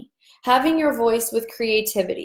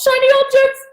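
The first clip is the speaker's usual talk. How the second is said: shiny objects in a squeaky voice, much higher and louder; squeaky mock voice; 8 dB louder, faster than usual. Host jokes about her own distraction, high squeaky mock voice. Playful self-distraction joke.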